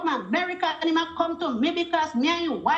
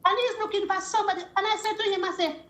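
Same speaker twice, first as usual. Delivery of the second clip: ordinary talk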